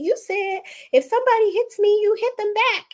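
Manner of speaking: high-pitched imitation of a protesting child; young son. Son reminds mother of her own rule, high-pitched protest. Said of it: "He said".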